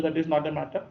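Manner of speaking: normal talking voice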